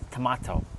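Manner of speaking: tomato said as to-MAH-to; aunt's tomato. Retold or imitated. Imitating aunt in Florida saying to-MAH-to. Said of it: "they say tomato".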